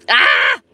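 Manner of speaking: loud low shout of frustration; frustrated shout. Real, not acted. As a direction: bark out in frustration. Struggling to open packet.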